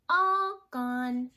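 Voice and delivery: a little cub wailing out; loud high baby bear. Acted. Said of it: "said Baby Blair".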